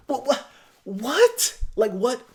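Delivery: incredulous repeated what; baffled by the guy's behavior; disbelief. Real, not acted. Disbelief at the exchange.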